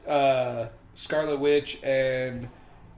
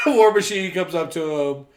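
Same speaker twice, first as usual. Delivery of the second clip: ordinary delivery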